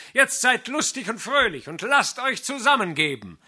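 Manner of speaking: loud, high, merry greeting; called out. A narrator's reading. Fiddler welcoming the wedding pair into the inn, loud merry call. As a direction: hail the couple across the room. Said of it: "rief der Geiger".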